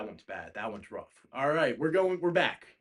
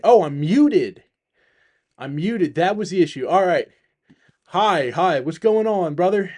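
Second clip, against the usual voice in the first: relieved loud greeting; 2 semitones higher, 11 dB louder than usual. Real, not acted. Realizes mic was muted, greets guest.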